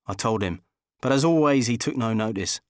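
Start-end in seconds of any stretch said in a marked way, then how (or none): none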